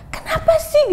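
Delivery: high, loud exasperated cry; exasperated yell. Retold or imitated. Mother yelling in frustration at her kids. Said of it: "cuman kayak teriak".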